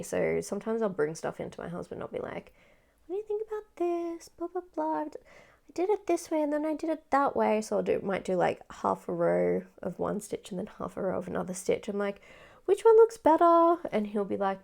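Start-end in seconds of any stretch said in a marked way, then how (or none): 3.1-7.6 high, put-on eager voice; mock eager asking. Asking her husband about stitches, high and eager. 12.6-13.8 loud high "Which one looks better?"; eager question. Retold or imitated. Asking her husband to pick a stitch, loud and high.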